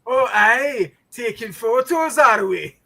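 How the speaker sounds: high, loud imitation Scottish greeting; mock Scottish accent. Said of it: "he thought I was Fat Bastard from Austin".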